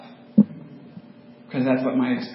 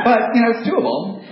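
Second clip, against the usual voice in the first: manic cheer; 10 semitones higher, 6 dB louder than usual. Retold or imitated. Manic happiness self-parody.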